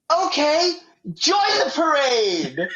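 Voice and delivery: mock announcer shrugging her in; baffled announcer. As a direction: wave her in, not knowing what she is. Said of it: "the guy's like".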